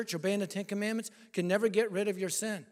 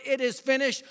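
proclaimed; 4 semitones higher than usual. Acted. Preacher shouting Jesus' last words to the crowd, proclaimed. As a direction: ring it out like a victory cry.

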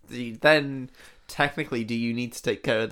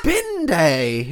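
louder, higher hammed-up "Bin day?"; mock delighted surprise; 6 semitones higher, 6 dB louder than usual. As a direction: act thrilled by the news. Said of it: "And I go".

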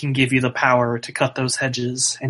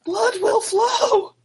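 ominous wail; slower than usual. Acted. Homeless man prophecy, repeated.

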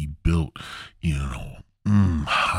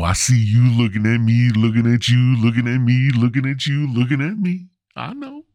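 smooth flirt; 5 semitones higher, 5 dB louder than usual. Retold or imitated. Flirting on the Ferris wheel.

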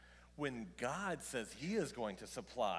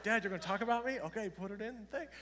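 put-on high, louder excited kid; a light hint of an excited child; eager kid voice; 8 semitones higher, 5 dB louder, faster than usual. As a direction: play the eager son asking. Kids voiced asking about sermon.

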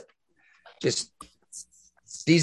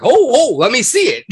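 eager excitement; 11 semitones higher, 8 dB louder, faster than usual. Retold or imitated. Retold eager reply.

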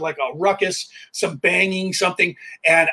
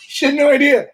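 laughing; 8 semitones higher, 4 dB louder than usual. Real, not acted. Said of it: "laughing at girlfriend".

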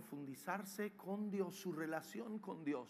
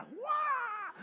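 hammed-up high wild cry; tigress pounce cry; 5 dB louder than usual. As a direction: pounce with a loud, high yell. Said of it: "la tigresa".

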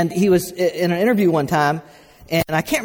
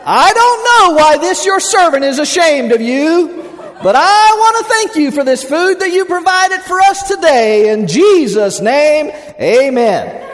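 booming, high-pitched mock prayer; slow, hammed-up shouted prayer; loud praying; 10 semitones higher, 7 dB louder, slower than usual. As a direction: belt out grace so the whole room hears. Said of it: "loudly, loudly. He starts praying".

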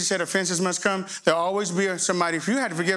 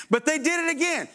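high, whining complaint; mock whine that they did it again; whiny protest; 7 semitones higher than usual. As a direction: protest like a sulky child. Whiny objection voiced.

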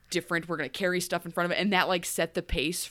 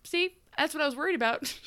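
high mock quote; 8 semitones higher, faster than usual. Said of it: "she goes".

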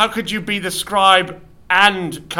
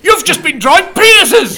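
high, loud indignant cry; outraged shout; 11 semitones higher, 7 dB louder than usual. Acted. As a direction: shout in disbelief at the scribe. Discovers what the scribe drew.

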